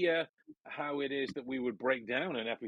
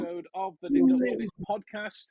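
grand host welcome; 10 semitones higher, 3 dB louder, faster than usual. Acted. Podcast host's grand welcome.

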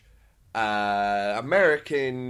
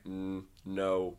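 dismissive refusal; 7 semitones lower, 10 dB quieter than usual. Retold or imitated. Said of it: "was like".

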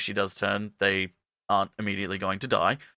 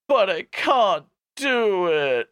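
slow, high melodramatic wail; hammed-up, loud reluctance; mock anguish; 8 semitones higher, 4 dB louder, slower than usual. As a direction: draw it out in overacted despair. Acting inability to kill teacher.

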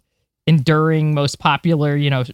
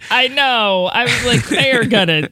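giggly; 7 semitones higher, 3 dB louder than usual. Real, not acted. Giggling while recalling prediction.

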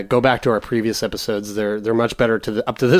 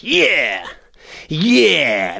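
satisfied groan; 3 semitones higher than usual. Retold or imitated. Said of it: "I come and I'm like".

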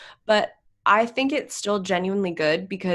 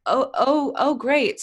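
high, loud mock enthusiasm; pitched-up, scornful "good for you" delivery; sarcastic congratulations; 8 semitones higher, 5 dB louder than usual. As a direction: fake being impressed by a brag. Sarcastic reply to imagined bragging.

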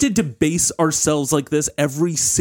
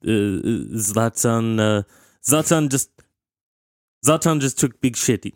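put-on Swedish accent; 3 semitones lower than usual. Zlatan in third person.

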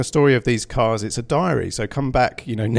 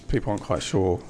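ordinary talk, nothing marked

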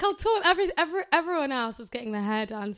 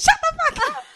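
mock squealing yell, much higher and louder; retold friend squealing at a FaceTime call; excited yell; 8 dB louder than usual. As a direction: shriek it like your friend did. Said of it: "she goes".

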